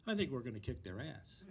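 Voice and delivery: normal talking voice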